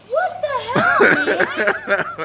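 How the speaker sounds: outraged but cracking up; calling the friend, laughing while protesting; laughing mock outrage. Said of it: "I'm like".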